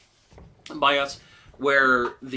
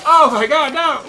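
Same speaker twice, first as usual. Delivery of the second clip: much higher, loud mock horrified cry; mock horror; 13 semitones higher, 13 dB louder than usual. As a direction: yell it in fake panic, high and loud. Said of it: "It's like".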